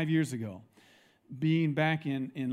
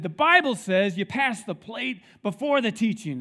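higher, louder know-it-all deacon; pompous deacon; 5 semitones higher, 5 dB louder than usual. Retold or imitated. Deacon insisting to the board, higher louder know-it-all. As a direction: lay down the rule like a self-sure deacon. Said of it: "one of my deacons stood up and said".

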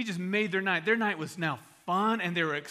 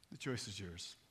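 very low, soft repeat; grave, intimate appeal; hushed challenge; 10 semitones lower, 12 dB quieter than usual. Real, not acted. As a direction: drop it almost to a murmur. Same challenge repeated after long pause.

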